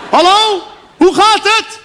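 high, loud bellowed greeting; shouting into phone. Retold or imitated. Father shouting a greeting down the phone to grandma in Morocco, loud bellow. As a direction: yell it as if the line reaches Morocco by voice alone. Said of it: "Die schreeuwde echt".

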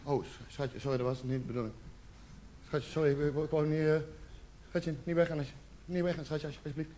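very soft, low stammered apology; hushed, faltering apology to a hurt lover; meek pleading. Acted. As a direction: beg quietly, halting and small. Acted pleading with crying girlfriend.

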